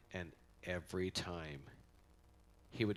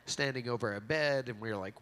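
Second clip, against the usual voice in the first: ordinary talk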